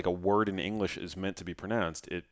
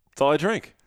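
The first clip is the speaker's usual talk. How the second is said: playful mock boast; 9 semitones higher, 8 dB louder than usual. Playing along with self-talk.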